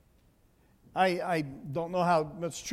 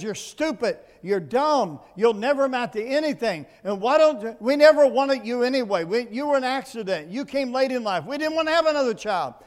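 louder, rising tirade of a rejecting father; imitated berating dad; 4 semitones higher, 6 dB louder than usual. Father putting down his unwanted son, imitated berating. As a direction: voice a dad's cruel words, building in force. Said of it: "Is that your dad's voice you hear?".

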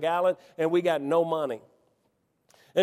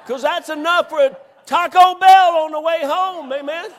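laughing shout, much louder; laughing exclaim; 8 semitones higher, 9 dB louder than usual. Real, not acted. Preacher tells the congregation the found money buys Taco Bell, laughing shout.

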